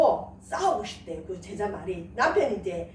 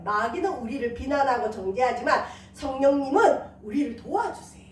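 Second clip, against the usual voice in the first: usual speaking voice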